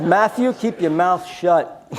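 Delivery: slow, laughing mock hush; laughing warning. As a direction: hush him through a laugh.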